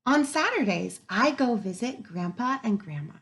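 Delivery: lively storybook read-aloud; reading to children. Acted. Teacher reading to her class, lively and expressive. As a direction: bring the story alive for the kids.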